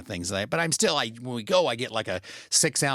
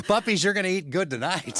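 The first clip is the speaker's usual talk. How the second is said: much higher, cooing pet voice; talking to puppies; 9 semitones higher than usual. Retold or imitated. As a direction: tease the dogs about their treat. Talking to the dogs with leftovers.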